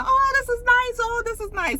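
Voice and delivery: high, gushing mock admiration; mock impressed. The girls touring rooms, high gushing mock praise. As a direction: coo over the room like a fake fan.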